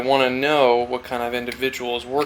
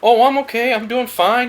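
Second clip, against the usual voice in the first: much higher and louder, chirpy fake cheer; fake fine answer; 11 semitones higher, 8 dB louder than usual. Barista's fake cheerful answer to the routine question. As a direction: paper over it with a sing-song fine. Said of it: "everybody just goes".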